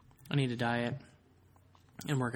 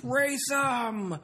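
much higher, louder shouted challenge; drunk shout; 11 semitones higher, 4 dB louder than usual. Retold or imitated. Imitating drunk friend shouting from the back seat, loud and high. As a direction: bark it like a rowdy drunk. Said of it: "He goes".

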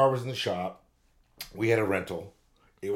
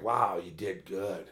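impressed wife; 4 semitones lower, 5 dB quieter, slower than usual. Retold or imitated. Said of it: "She's like".

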